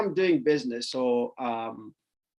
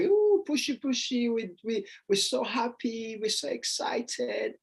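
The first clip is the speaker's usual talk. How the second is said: put-on high sing-song baby talk, softer and slow; cooing parent; 8 semitones higher, 4 dB quieter than usual. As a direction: coo at the toddler like a proud parent.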